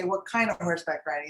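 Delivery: ordinary talk, nothing marked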